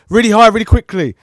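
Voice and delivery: high, fast squeaky burst; really high and quick. Shown as a demonstration. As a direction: flick the voice up high and rush it. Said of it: "back to high".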